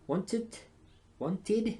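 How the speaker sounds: "Wanted" said high with a clear final "id"; clear demo of "Wanted". Shown as a demonstration. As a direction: stretch "Wanted" into two syllables, "want-id", rising. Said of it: "Esa termina con I D".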